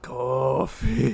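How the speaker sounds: long, slow zombie word; drawn-out mock-undead plea; zombie imitation. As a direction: drag it out like a zombie.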